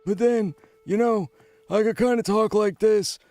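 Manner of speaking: put-on high-pitched old man voice; elderly gentleman. Shown as a demonstration. Demonstrating a made-up voice, been around, elderly gentleman. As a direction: talk like an aged fellow, pitched well up. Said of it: "this could kinda pass as an elderly gentleman".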